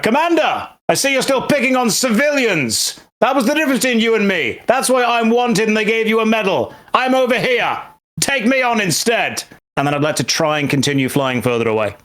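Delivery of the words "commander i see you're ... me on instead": high, taunting call across the distance; defiant yell. Acted. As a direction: provoke him into chasing you. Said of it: "turn around and yell".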